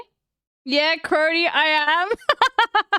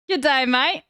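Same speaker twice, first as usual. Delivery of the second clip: a lightly put-on Australian "Good day, mate"; Australian accent. Streamer mocking friend's future Australian accent, light Aussie twang. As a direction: toss off a jokey Australian greeting. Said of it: "starts talking with an Australian accent".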